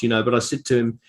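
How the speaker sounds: normal talking voice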